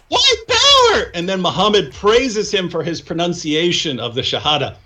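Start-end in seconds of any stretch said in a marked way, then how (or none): 0.1-1.1 very high, slow, loud drawn-out shout; hillbilly accent. Retold or imitated. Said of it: "And the guy goes".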